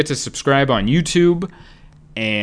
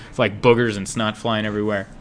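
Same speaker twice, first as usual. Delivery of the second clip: ordinary talk, nothing marked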